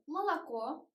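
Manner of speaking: "молоко" as muh-la-KO, first vowels short and lax; reduced vowels. Shown as a demonstration. Teacher summing up the rule, "молоко" with schwa reduction. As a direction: say "молоко" with a barely open mouth before stressed KO. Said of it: "just A, a little open mouth".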